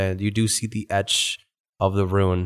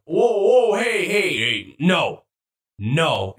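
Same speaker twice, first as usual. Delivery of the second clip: alarmed armor; 6 semitones higher, much slower than usual. Acted.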